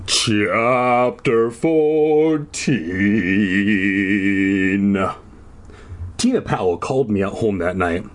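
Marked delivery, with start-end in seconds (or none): 0.1-5.0 very drawn-out, stretched words; lower, elongated title reading; mock-grand chapter announcement. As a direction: announce it slowly, dragging every syllable. Announcing the chapter heading theatrically.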